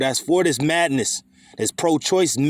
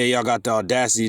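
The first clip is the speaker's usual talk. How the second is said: normal talking voice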